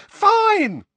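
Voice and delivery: very high, loud, separate barks; exasperated mother screaming orders; furious shout. A narrator's reading. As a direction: yell the order word by word. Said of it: "shouted Missus Weasley".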